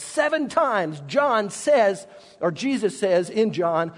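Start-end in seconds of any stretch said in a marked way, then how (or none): none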